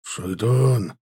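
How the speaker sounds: feeble hoarse call; wounded man's weak, rasped cry. A narrator's reading. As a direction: croak out the name as a dying man. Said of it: "позвал он снова".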